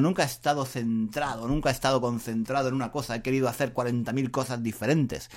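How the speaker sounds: fast rush of words; talking too fast. Real, not acted. Podcaster racing ahead of his listeners. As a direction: rattle it off too quickly. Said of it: "Estoy hablando muy rápido".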